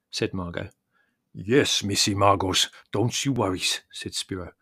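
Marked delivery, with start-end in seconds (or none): 1.3-3.6 loud, wine-thickened deep voice; thick deep voice. A narrator's reading. Spiro reassuring Margot, deep and thickened with wine. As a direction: reassure her through the drink. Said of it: "his deep voice thickened with wine".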